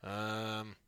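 soft, held-out listless filler; Joe Reddington impression. Comic mimicking a fellow comic on stage, depressed mumbling. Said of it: "here's my impression of Joe Reddington".

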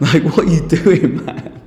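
laughing it out, loud; real laughter breaking the words; laughing in disbelief. Real, not acted. Laughs through the line.

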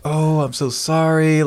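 slow, high, cooing fake pity; mock sympathy. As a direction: imitate overdone condolences, drawn out. Mimicking unwanted sympathy after a loss.

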